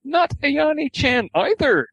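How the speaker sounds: put-on high girlish character voice; Kasumi. A narrator's reading. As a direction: lift it up into a young girl's pitch. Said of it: "Kasumi shook her head".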